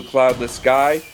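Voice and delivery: usual speaking voice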